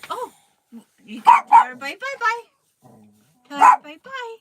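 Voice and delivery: high, loud, fast sing-song; excited coaxing. Real, not acted. Loud sing-song prompt to toddler.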